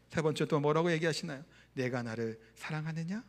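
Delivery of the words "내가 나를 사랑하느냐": low, soft, tender asking; gentle question. Retold or imitated. Jesus asks Peter a third time, soft and gentle. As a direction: ask very softly, lifting at the end.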